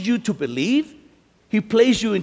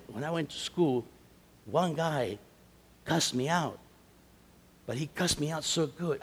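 ordinary talk

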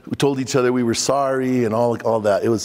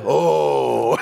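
crowd's oh; 2 semitones lower than usual. Retold or imitated. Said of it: "And the crowd said".

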